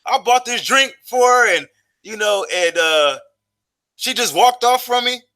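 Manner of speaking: high, slow, aggrieved protest; pleading explanation. Retold or imitated. To the bouncer kicking him out, high pleading defence. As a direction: make the bouncer see you were wronged. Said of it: "trying to explain to him".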